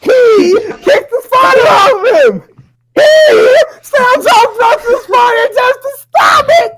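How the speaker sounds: high, loud, hyped-up play-by-play; excited shouting. Real, not acted. Narrator hyping the spider fight to friends, excited shouting. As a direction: yell the action like it's a big match.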